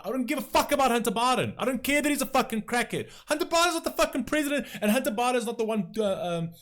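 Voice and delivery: shouting down the talking point; real furious shouting. Real, not acted. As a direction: blast it out in exasperation. Angry rant about Hunter Biden.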